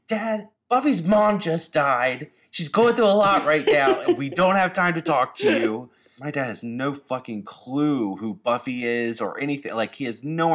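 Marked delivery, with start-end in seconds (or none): 0.1-5.9 high, loud, frustrated half-sob; broken half crying. Retold or imitated. Sister retelling snapping at her dad through half-tears. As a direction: voice cracking with frustration and grief. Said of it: "like, broken, like, half crying, very frustrated voice".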